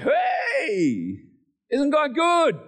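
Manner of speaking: high, drawn-out celebratory call; acted victory whoop; elated cheer. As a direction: cheer it out like you just won. Acting out elation after victory.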